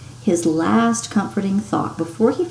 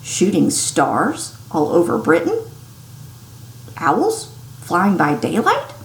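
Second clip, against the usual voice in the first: usual speaking voice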